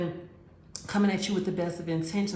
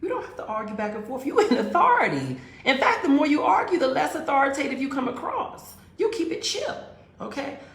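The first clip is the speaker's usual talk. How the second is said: higher, louder, laughing; excited laughing advice; 8 semitones higher, 6 dB louder than usual. Real, not acted. She preaches her dating rule, laughing and loud. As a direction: get fired up about the point. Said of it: "excited laughing advice".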